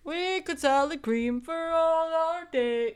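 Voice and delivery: slow, high, wistful fantasy voice; dreamy daydream voice. Pretend. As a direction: drift off into a dreamy wish. Continues the imagined daydream voice.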